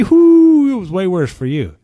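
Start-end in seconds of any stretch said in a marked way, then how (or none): 0.0-0.7 loud, high triumphant whoop; gloating whoop. Retold or imitated. Husband gloating at his wife, loud high whoop. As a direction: whoop it up over her.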